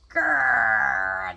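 long, loud, rolling growl; tiger growl. A narrator's reading. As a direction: draw out an angry tiger's growl. Tigers growl, running faster.

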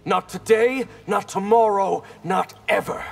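high, forceful defiance; fierce, high-pitched defiance; defiant refusal. Acted. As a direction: refuse your enemy, hitting each phrase hard. Defies threat of being brought down.

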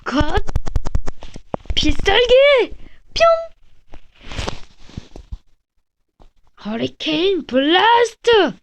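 high, slow anime attack yell; special move shout. Acted.

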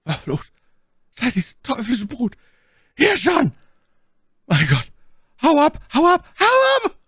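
strained high voice swelling into full screaming; rising to a shriek. A narrator's reading. Cursing the brood and warning to flee, building to shrieks. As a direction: build from a tense curse to a horrified shriek. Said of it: "schwoll Carters Flüstern zu einem Schrei an".

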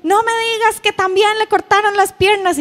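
put-on high-pitched, loud childish outcry; hammed-up shriek of horrified disbelief; dramatic mock scream. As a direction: imitate a drama-queen daughter in a panic.

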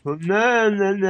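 high, loud, drawn-out protest; hammed-up high wail of refusal; mock dismay. Mock dismay during the game.

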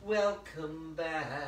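very slow, stretched welcome; long, slow playful repeat of the welcome; drawn-out greeting. Real, not acted. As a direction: draw out each word, lingering on BACK.

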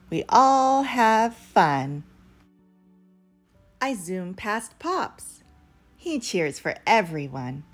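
usual speaking voice

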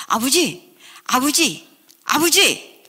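high calls building to a shout; calling out. Retold or imitated. Child rushing into the house to father, calling out. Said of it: "불렀더니요".

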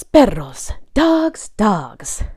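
ordinary delivery

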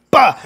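a sharp loud high yelp of pain; mock pained grunt. Dad mimicking getting kicked, loud pained grunt. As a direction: blurt a pained grunt as if hit. Mock reaction to groin kick.

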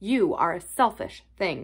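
plain reading voice

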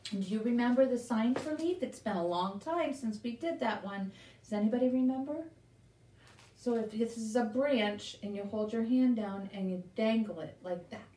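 ordinary talk, nothing marked

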